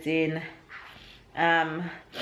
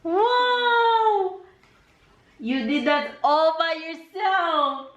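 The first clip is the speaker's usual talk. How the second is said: excited praise; 10 semitones higher than usual. Real, not acted. Praising toddler's building.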